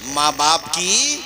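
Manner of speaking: slow drawn-out cue for the crowd to finish; drawn-out prompt. Shown as a demonstration. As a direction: stretch it so they answer. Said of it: "بولو نا زور سے". (سامعین کو جواب کے لیے کھینچ کر اشارہ)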